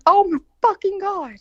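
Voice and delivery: high-pitched retold horror; mortified exclamation. Learning of the call to mom, high and mortified. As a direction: exclaim in dismay, voice jumping up.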